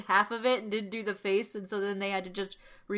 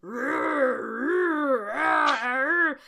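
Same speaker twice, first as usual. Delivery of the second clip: monster growls; 2 semitones higher, 3 dB louder, much slower than usual. Retold or imitated. Monster growling before speaking.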